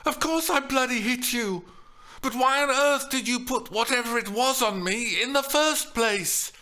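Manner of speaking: high, loud indignant rant; heated high-pitched accusation; outraged. A narrator's reading. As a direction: let the outrage pour out.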